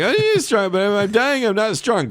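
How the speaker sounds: high, straining dying hero; strong but dying. Pretend. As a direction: play it strong yet dying. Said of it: "Can you do that?".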